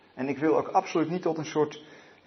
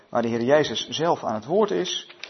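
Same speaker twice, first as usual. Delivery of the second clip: usual speaking voice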